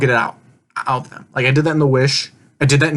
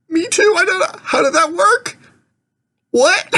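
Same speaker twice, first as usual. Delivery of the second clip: 높은 어리둥절한 목소리로 반문하듯; baffled squeak. Retold or imitated. Retelling own baffled reply.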